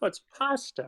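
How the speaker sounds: "pasta" mocked with a flat, front "a" like "past"; imitating pronunciation. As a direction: echo his "pasta" back in disbelief, high. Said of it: "he's putting this weird emphasis on".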